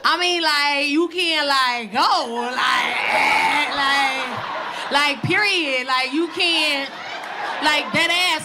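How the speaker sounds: loud, confrontational mom yelling; mock confrontation. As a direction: get in her face, loud. Mom confronting teen daughter.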